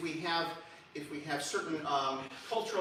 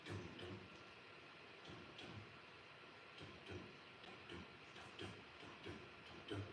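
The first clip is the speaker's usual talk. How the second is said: barely voiced dum dum like a pulse; whispered heartbeat rhythm; 9 semitones lower, 21 dB quieter, much faster than usual. Shown as a demonstration. Teacher giving just the rhythm, whispered softly. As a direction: breathe out the beat without pitch. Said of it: "you just listen to the rhythm".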